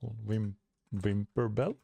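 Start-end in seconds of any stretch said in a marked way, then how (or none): none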